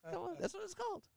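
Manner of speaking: high-pitched protest; incredulous insistence. Real, not acted. Defending the hotel joke to scoffing friends, high incredulous insistence. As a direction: push the punchline again, way up high.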